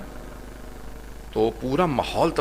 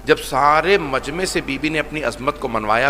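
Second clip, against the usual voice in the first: ordinary talk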